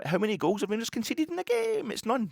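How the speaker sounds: high, hammed-up mock Welsh title; lightly mocked Welsh accent. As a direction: announce it like the Welsh lad's video. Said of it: "I can't do the Welsh accent".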